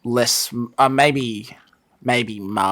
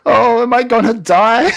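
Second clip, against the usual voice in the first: loud, high mock-alarmed kid voice; Bart; 10 semitones higher, 7 dB louder than usual. Boy asks whether he's gonna die, loud high mock alarm. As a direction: imitate a cartoon boy asking if he's dying. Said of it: "When Bart says".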